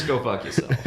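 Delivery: put-on rough Brooklyn accent. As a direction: tell him off in Brooklyn accent. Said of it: "In a Brooklyn accent".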